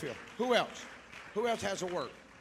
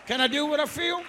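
loud, ringing preacher's cry rising higher; full-force preaching; 4 semitones higher, 16 dB louder than usual. Real, not acted. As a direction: preach it out with everything you have.

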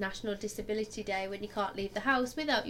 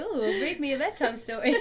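swooning; 3 semitones higher, 6 dB louder than usual. Retold or imitated. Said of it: "I really like the guy's voice".

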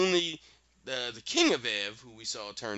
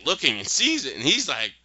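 ordinary delivery

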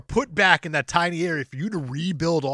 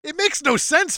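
ordinary talk

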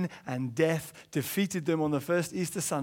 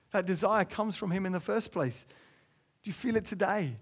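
normal talking voice

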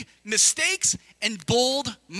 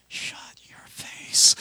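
slow, breathy whispered retort; mock whisper; much slower than usual. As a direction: hiss it under your breath, mock annoyed. Pastor's mock retort at bridesmaids.

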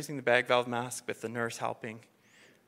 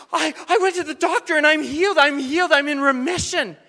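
high, loud, breathless joy; overjoyed; 12 semitones higher, 13 dB louder than usual. Retold or imitated. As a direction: blurt out the good news, much higher and louder. Lady announcing she is healed.

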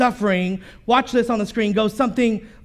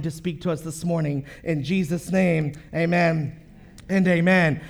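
pulpit prayer; 4 semitones lower than usual. Acted. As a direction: pray over the congregation. Pastor leads opening prayer.

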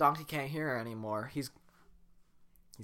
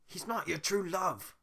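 slow Scottish Shrek line; Scottish accent; 6 semitones higher than usual. Acted. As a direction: try the Scottish accent properly. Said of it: "not Scottish like Shrek is".

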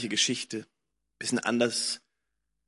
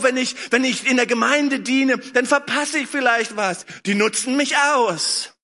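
higher, louder fervent preaching; 10 semitones higher, 5 dB louder than usual. Real, not acted. Preacher voicing doubting hearts, fervently. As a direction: push the doubts out with energy.

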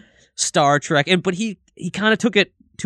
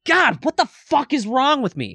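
self-exasperated; 11 semitones higher than usual. Retold or imitated. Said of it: "be like".

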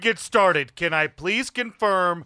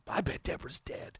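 whispered aside; whispering; 14 dB quieter than usual. Real, not acted. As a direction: hush it so the captor can't hear.

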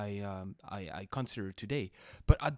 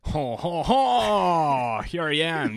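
booming ho ho ho, much higher and louder; hearty Santa-like laugh to announce yourself; jolly entrance; 5 semitones higher, 11 dB louder, much slower than usual. Acted. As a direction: make a big show of arriving. Guest's grand entrance on podcast.

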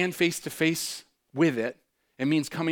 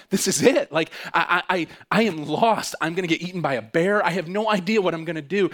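louder, higher panicked thoughts; worked-up, stammering alarm; retold terror; 3 semitones higher, 6 dB louder than usual. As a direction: relive the panic of being lost. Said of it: "because I was so terrified".

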